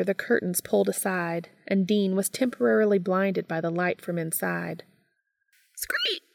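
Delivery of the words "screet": high-pitched little "screet"; short, piping bat cry; bat screech. A narrator's reading. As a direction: squeak out a very high bat call. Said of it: "Dean called out".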